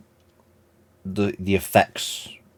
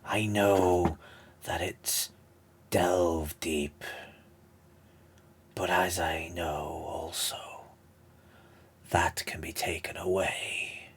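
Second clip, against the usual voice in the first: slow, halting villain voice sinking into a hush; Palpatine; slower than usual. Acted. Villain offers to take away pain, slow hushed temptation. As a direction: tempt them slowly, with long pauses. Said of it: "that Palpatine voice".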